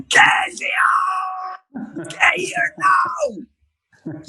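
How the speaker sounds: sudden high fake-furious yell, slow and drawn; pretend-furious dad shouting for his daughter; mock angry shout. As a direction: bark her name like she's in big trouble. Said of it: "I've got this angry look on my face".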